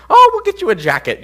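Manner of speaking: lightly hammed-up motherly reassurance; reassuring mother. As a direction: imitate mom brushing the worry away. Mother's breezy reassurance, acted.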